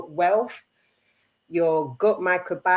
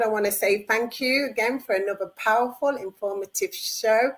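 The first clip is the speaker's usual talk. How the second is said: ordinary talk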